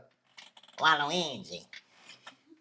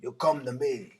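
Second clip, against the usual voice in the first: low, quiet mobster rasp; Godfather impression; 4 semitones lower, 4 dB quieter than usual. Doing the Godfather for a friend, a low mock mobster voice. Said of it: "That's my impression".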